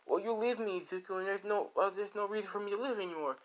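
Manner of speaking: high-voiced, plaintive character; acted despairing plea. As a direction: plead as the desperate girlfriend. Rose pleads, threatening to end life.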